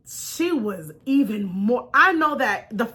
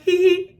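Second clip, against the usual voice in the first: high, playful answering hee hee; giggle back; 6 semitones higher, 5 dB louder than usual. Retold or imitated. Greeting the mom back with a playful giggle. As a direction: giggle back at her warmly. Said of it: "And I was like".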